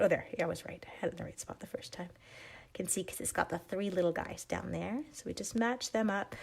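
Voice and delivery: whispered aside to herself; breathy murmur as she checks the placement. Real, not acted. Checking stamp placement to herself, soft whisper.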